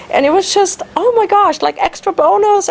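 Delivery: high, bright rush of delight; squealing oh my gosh excitement; thrilled. Retold or imitated. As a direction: gush with excitement. Thrilled at meeting Harrer's acquaintance.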